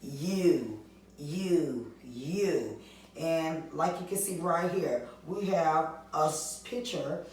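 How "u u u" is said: "U" said as a clear long you sound three times. Shown as a demonstration. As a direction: model the long "U" for kids. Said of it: "the long U sound give you what".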